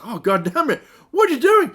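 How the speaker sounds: loud, rising into a high shriek; a villain shrieking as his prisoners escape; mock outraged shout. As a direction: yell it like a furious robot. Tronic gets up, outraged.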